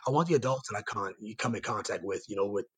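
ordinary talk

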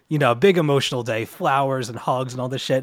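ordinary talk, nothing marked

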